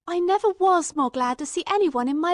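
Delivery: high, loud, glad outburst; overjoyed. Acted. Watson greeting Holmes after a long search, glad outburst. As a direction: burst out with relief.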